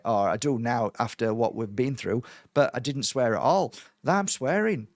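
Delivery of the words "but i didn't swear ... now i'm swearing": normal talking voice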